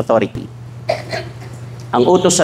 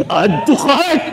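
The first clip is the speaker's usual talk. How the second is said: high, gleeful "Add to cart!"; giddy shopper; 10 semitones higher, slower than usual. Acted. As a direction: squeal it like a shopaholic. Mimicking wife shopping a sale.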